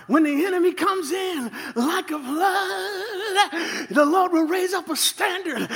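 high, slow, preached cry; stirred-up preacher. Retold or imitated. Retelling an Alabama offering preacher, high, slow and preached. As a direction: draw out the verse like a preacher working up a frenzy. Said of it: "he's getting stirred up".

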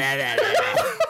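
high, wordless mock babble; crazed-mother noise, hammed up; mom impression. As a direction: jabber wildly in a high put-on voice. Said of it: "Joe's impression of the mom".

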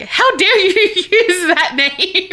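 high, slow, laughing mock indignation; mock outrage. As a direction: play offended royalty, drawn out. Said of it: "she was like".

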